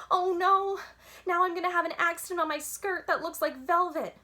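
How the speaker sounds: high, loud, urgent panic; frantic little girl; panicky. A narrator's reading. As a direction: blurt it out in a desperate rush. Girl panics about wetting herself.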